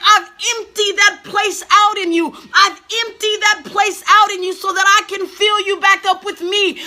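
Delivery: fervent voice of God pleading; voicing God. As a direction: speak as God, aching and urgent. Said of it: "he's like".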